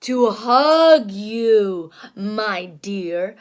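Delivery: low, very slow wolf; sly wolf. Acted. Wolf answering the girl, low and slow. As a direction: purr it slyly. Wolf's reply.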